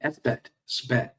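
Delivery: slow "S bet" then "sbet" as one word; slow contrast of two readings; both ways. Shown as a demonstration. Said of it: "S bet is a better way to say that".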